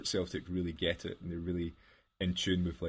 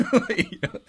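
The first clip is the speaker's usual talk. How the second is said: high laughing burst, much louder; 9 dB louder than usual. Real, not acted. Host cracking up at his own story, laughing loud and high.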